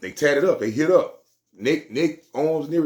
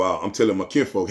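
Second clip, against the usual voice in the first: ordinary talk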